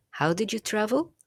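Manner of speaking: English question tune rising up at the end on "travel". Shown as a demonstration. Teacher showing English questions rise at the end. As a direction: lift the pitch up through "How did you travel?". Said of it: "in English I'm going up an intonation in my questions".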